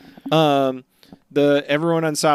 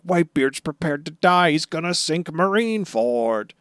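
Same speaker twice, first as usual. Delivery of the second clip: mock panic, pitched up and louder; freaking out; 6 semitones higher, 4 dB louder than usual. Sengoku reacts to Whitebeard about to sink Marineford, mock freak-out. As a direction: imitate a man freaking out. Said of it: "freaking out".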